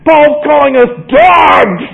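high, loud, drawn-out indignant yell; outraged outburst. Acted. Judaizers hitting the ceiling at Paul's words, outraged. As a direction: blow up in hammed-up outrage.

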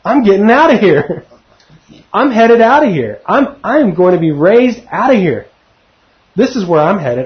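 acted-out eager anticipation, pitch swooping up then falling; Paul. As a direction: voice his excitement at being about to leave. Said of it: "was going on in Paul's mind".

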